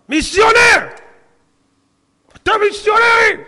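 high, loud angry shout of missionary; barking accusation. Retold or imitated. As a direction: bark the accusation at him. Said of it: "נובח, נובח".

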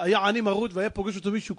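normal talking voice

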